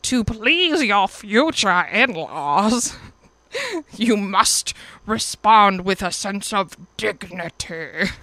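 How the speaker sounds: slow, prim, haughty matchmaker instructing a bride; drawn-out prissy matchmaker voice; Mulan matchmaker impression. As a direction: lecture like a stiff old matchmaker, very slowly. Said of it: "the matchmaker from Mulan when she's like".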